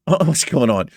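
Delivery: ordinary talk, nothing marked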